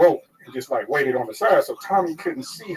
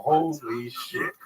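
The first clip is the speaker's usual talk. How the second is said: drawn out very slowly, softer; stunned, slow; 5 dB quieter, much slower than usual. Retold or imitated. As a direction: let it out slowly in shock. His stunned reaction.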